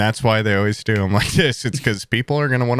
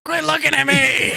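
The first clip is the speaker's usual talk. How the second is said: very high, put-on squawk; child robot voice. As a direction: shriek it as an indignant little robot. Acting the child-sized care robot.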